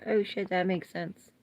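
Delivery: normal talking voice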